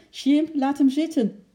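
high, loud anxious call; nervous shout. A narrator's reading. Girl to ape up in the tree, nervously calling out. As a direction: call out nervously to stop him. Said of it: "riep Lotje zenuwachtig".

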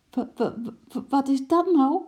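high stutter building to a loud question; flabbergasted, voice climbing; astonished stammer. A narrator's reading. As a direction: stammer in shock. Astonished character stammers at the sight.

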